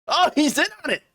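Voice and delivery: high sarcastic oh, he's in on it; mock realization. Host mocking the conspiracy logic, high sarcastic gasp. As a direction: pitch it up like you just figured it out.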